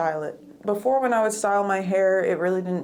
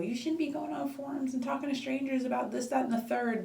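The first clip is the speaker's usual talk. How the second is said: mock critic; 6 semitones higher, 6 dB quieter than usual. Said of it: "I might get attacked for the whole".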